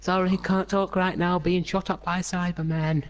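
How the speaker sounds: high-pitched mock excuse; mock hurried Doctor. The Doctor begging off while under Cyberman fire, mock hurried. Acting out Doctor's rushed message.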